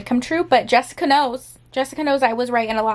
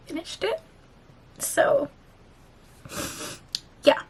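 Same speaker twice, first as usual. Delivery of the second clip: normal talking voice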